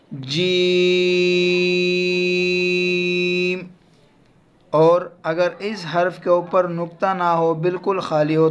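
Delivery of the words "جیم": long held Jeem; letter name drawn out. Shown as a demonstration. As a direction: Jeem stretched very slowly like a recitation. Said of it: "اس کو ہم کیا پڑھیں گے".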